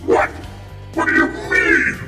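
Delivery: normal talking voice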